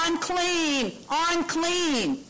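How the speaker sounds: high-pitched, drawn-out call of warning; yelled warning. Acted. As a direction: call it out high, a restrained yell. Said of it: "you had to yell out".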